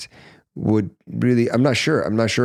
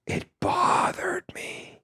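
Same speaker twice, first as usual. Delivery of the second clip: hushed whisper; 7 dB quieter, slower than usual. Real, not acted. Telling how the table incident hurt.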